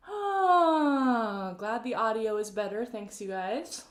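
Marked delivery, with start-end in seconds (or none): 0.1-1.6 high loud sighing ah; open sigh. Shown as a demonstration. Coach sighing while massaging the face. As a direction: let out a big open ah. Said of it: "yawn demo".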